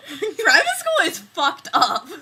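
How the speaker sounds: laughing through the words; loud laughing disbelief. Real, not acted. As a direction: crack up while saying it. Laughing about awful school stories.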